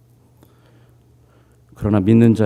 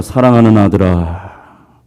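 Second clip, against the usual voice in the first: slow, louder fatherly call of God; God's loving call; 6 dB louder, slower than usual. Retold or imitated. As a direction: draw out 사랑하는 slowly, louder, like a loving father. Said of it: "귀에 들려오는 응답은 이렇습니다". (느리고 크게 부르는 하나님의 목소리)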